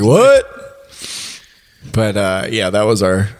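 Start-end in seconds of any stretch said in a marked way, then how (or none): 0.0-1.9 very high-pitched, startled question; high yelp of imitated surprise; mock startled cry. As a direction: act out being caught off guard, pitch leaping up. Acted startled reaction to the scream.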